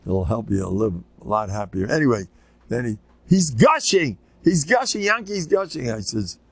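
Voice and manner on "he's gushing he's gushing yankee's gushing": put-on dramatic kid.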